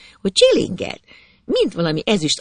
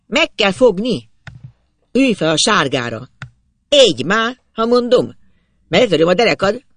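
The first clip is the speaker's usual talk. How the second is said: raised, higher father's voice; angry orders; 5 semitones higher, 4 dB louder than usual. A narrator's reading. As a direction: order the lad off with a threat. Father orders and threatens his lad.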